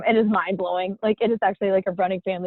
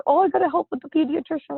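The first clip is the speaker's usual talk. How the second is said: high, put-on voice of her nurse mom; mock her mother's dutiful tone, pitched up; a light impression of a caring grandmother; imitating her mom; 9 semitones higher, faster than usual. Imitating her mom's reasoning.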